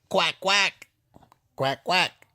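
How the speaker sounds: short paired quacks, rising; small duck noises in twos. Acted. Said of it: "says the duck".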